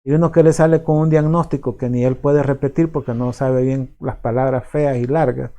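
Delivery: ordinary delivery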